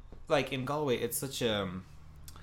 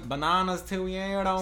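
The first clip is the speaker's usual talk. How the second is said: put-on Dublin accent; 6 semitones higher than usual. Imitating Dublin street traders.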